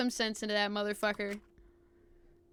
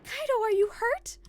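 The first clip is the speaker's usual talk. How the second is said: high-pitched worried call; alarmed concern; 12 semitones higher than usual. Acted. Rushing to fallen Kaito's side, alarmed. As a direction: check on a hurt friend, voice jumping up.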